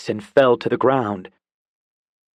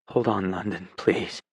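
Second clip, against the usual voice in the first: lower, softer tender plea; 4 semitones lower, 6 dB quieter than usual. Acted. Dawson comforts dying London, tender and soft. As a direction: hold her with your voice.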